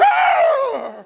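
piercing high loud scream out of nowhere; sudden shriek. Retold or imitated. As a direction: shriek like the little sister. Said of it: "and I hear".

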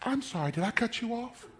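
very soft, timid sorry; old man looking up, suddenly soft and meek; meek apology. Retold or imitated. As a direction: shrink back and apologize. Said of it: "he goes".

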